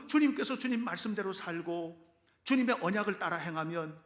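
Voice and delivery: ordinary talk, nothing marked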